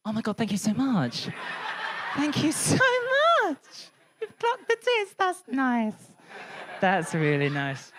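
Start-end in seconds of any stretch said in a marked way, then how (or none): 0.0-5.9 mock gushing. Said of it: "I'm like".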